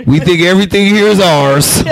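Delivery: mock reply to a friend. Telling a friend off with a laughing high voice. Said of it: "I was like".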